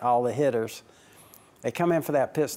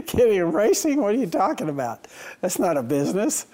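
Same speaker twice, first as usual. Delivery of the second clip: high, loud mocking disbelief at "Getting in racing?"; imitating doubters who think the idea is absurd; incredulous scoffing; 7 semitones higher, 4 dB louder than usual. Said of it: "obviously people go".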